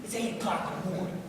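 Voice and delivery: low, soft weary groan; acted low-energy morning complaint; grumpy morning reply. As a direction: imitate a tired coworker grumbling. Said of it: "And you're like".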